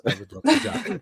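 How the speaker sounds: high-pitched retold reply; eager acceptance. New hire answering the CFO's job offer, high and eager. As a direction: act out the answer, voice jumping up high.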